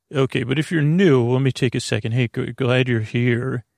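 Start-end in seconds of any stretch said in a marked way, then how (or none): none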